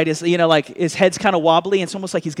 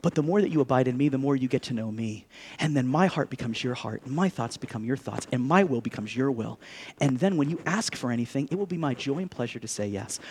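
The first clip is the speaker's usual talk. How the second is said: gentle Jesus; 6 semitones lower, 5 dB quieter than usual. Retold or imitated. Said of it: "'Cause Jesus is saying".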